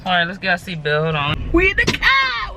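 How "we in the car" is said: excited shout. Real, not acted. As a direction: shout it out joyfully. Announcing from inside.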